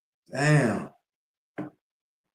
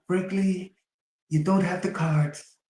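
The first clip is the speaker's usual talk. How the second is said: much higher, mocking Trump voice; Trump impression; 10 semitones higher than usual. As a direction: squeeze it up high like a mock Trump. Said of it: "Trump said".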